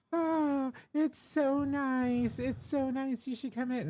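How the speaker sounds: very high, slow dreamy coo; airy influencer voice. Retold or imitated. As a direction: drawl it like a blissful tourist. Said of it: "And she's like".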